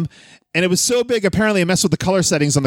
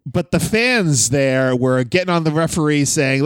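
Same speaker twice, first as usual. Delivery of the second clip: ordinary talk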